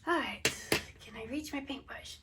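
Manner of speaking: ordinary delivery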